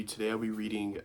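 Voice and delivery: usual speaking voice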